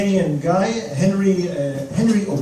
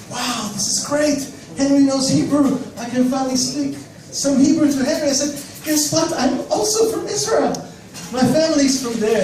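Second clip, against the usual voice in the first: excited; 8 semitones higher than usual. Retold or imitated. Excited he can speak Hebrew.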